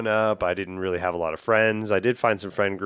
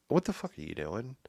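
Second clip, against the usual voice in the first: imitating exasperated dad; 5 semitones higher than usual. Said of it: "dad would just say".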